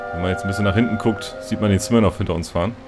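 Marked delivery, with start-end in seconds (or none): none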